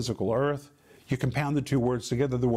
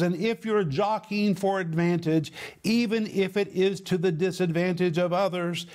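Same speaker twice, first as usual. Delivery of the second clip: raised, insistent preaching pitch; emphatic scripture reading; 5 semitones higher than usual. Acted. Preacher reading an expanded verse to the congregation, emphatic. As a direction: build the list relentlessly, held high. Reads his expanded Bible paraphrase.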